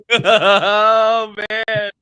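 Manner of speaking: high, loud laughing exclamation. Retold or imitated. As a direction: laugh right through the words. Reacts laughing to old memories.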